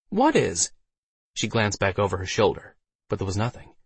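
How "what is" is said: high-pitched puzzled female voice; questioning Alice. A narrator's reading. Alice to Waldo, asking what follows them, puzzled. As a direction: pitch the question high, then let it drop.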